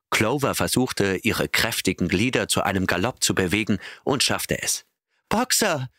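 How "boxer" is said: very high, calling out; desperate cry. A narrator's reading. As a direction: scream his name after the cart. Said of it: "rief sie". (sehr hoher, verzweifelter Ruf)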